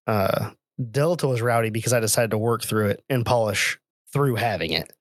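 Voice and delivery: normal talking voice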